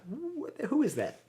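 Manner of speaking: high bewildered what the; startled disbelief. Retold or imitated. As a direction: jump up in pitch, baffled by the voice. Hearing own voice recorded.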